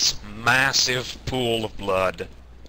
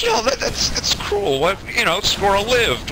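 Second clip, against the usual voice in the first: high-pitched, pleading protest; squeamish friend; 8 semitones higher than usual. Retold or imitated. Friend protests killing the squirrel in a high voice. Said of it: "And the guy says".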